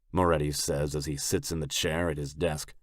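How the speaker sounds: usual speaking voice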